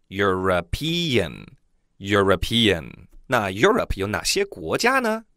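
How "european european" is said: European said slowly and clearly twice; teacher's model word. Shown as a demonstration. Teacher to students, slow careful model. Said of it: "欧洲人叫European".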